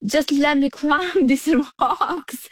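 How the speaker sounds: retold childhood plea, amused and drawn out; laughing plea. Young climber begging dad in retelling, laughing plea.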